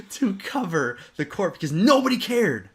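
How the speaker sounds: words through a laugh. Real, not acted. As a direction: still laughing while talking.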